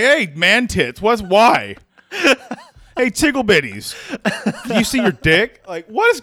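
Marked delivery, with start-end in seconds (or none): 0.0-1.8 slow, louder mock taunt; mildly hammed-up friendly jeer; teasing taunt. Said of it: "He's like". 3.0-5.6 laughing taunt. Retold or imitated. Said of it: "retold taunt".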